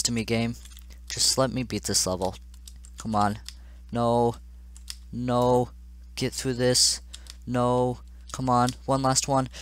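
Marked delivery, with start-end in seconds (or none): none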